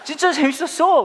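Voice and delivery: put-on high, loud, slow child's gush; thrilled kid. Child telling dad about camp, high and gushing. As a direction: stretch it out like a delighted kid. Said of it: "그랬다는 거예요".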